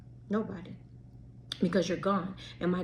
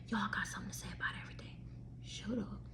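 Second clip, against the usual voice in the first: fast whispered aside, almost unvoiced; whispered brush-off; 14 dB quieter, faster than usual. Real, not acted. Whispering to the judging crowd, dismissing them. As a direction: hiss it off under your breath, quick.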